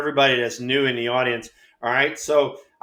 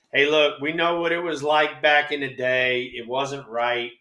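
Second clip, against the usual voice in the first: ordinary delivery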